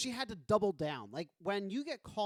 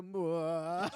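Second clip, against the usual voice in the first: Welles mwah. Retold or imitated. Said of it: "They go".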